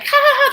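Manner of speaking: high girly fake giggle; mock giggle. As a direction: giggle like a fangirl. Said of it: "They're just, like".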